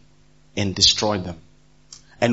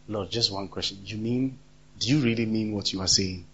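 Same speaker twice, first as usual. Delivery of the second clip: quiet pleading question; 4 semitones lower, 8 dB quieter than usual. Acted. Voices doubting people asking God.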